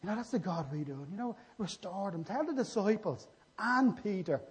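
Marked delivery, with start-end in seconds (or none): 2.2-4.4 "and Peter" slow, raised and emphatic; stress on Peter. Acted. Preacher repeating the angel's message, stress on "and Peter". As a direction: draw out the phrase, landing hard on "and Peter". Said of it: "picking Peter out".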